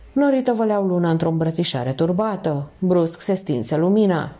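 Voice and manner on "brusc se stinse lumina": ordinary delivery, nothing marked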